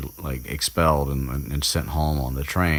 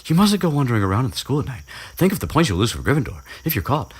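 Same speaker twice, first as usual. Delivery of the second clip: higher, fast prissy lecture; mock bossy rant; 9 semitones higher, faster than usual. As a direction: rattle it off like a know-it-all. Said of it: "she goes into her little rant".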